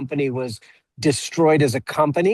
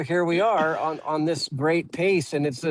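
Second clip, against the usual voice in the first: ordinary talk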